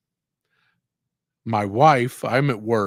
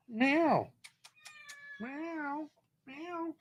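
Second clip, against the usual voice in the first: soft cat meows; 12 dB quieter, much slower than usual. Pretend. Calling the hiding cat.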